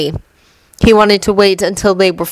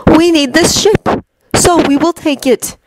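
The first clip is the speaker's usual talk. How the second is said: higher, measured villain voice; Loki's cool, higher menace; cold god Loki; 9 semitones higher than usual. Acted. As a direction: lay out the plan like a calm schemer.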